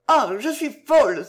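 high, loud exclamation; crying out. A narrator's reading. Lucienne alone, mad with feeling, crying out. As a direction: burst out, overwhelmed. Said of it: "s'écria-t-elle".

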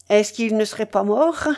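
usual speaking voice

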